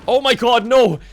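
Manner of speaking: loud, high startled cry; shocked shout. Real, not acted. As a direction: yelp as the branch comes down. Tree branch threatens the team.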